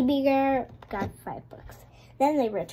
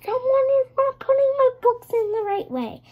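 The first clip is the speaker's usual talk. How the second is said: much higher, fast fussy librarian voice; high-pitched indignant mama bear; mother bear; 12 semitones higher, 4 dB louder, faster than usual. Acted. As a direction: squeak it out like a prim mother bear. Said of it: "So she was like".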